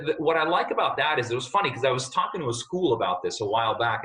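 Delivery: usual speaking voice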